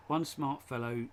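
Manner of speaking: slow, careful first read; measured read-out of a tongue twister. Shown as a demonstration. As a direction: take the tongue twister slowly, word by word.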